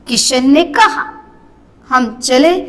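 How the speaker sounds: rising कहा, a clear pause, then हम चले; किशन ने कहा, held break, हम चले; comma pause. Shown as a demonstration. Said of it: "वहां पर रुकना है".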